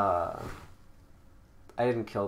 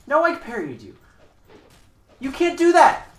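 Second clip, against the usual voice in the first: outraged yell; 13 semitones higher, 14 dB louder than usual. Real, not acted. Angry protest at opponent in duel.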